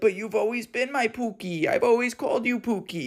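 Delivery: high mock woman's voice; protesting mom. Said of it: "this woman goes on to be like".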